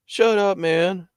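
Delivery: high, loud retort; high-pitched mock complaint; mock protest. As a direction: imitate an annoyed friend telling you off. Friend snapping back, retold.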